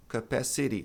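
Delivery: "capacity" as kuh-PASS-uh-REE, clear final ee; American "capacity" ending in ree; final I sound. Shown as a demonstration. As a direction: flap the T in "capacity" before a clear ee. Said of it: "tem som de I".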